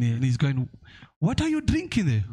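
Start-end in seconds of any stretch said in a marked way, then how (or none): 1.2-2.2 put-on high, lightly Nigerian-accented question from a professor; playful Nigerian accent. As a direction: imitate the professor asking the boy, pitched up. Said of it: "about these Nigerians".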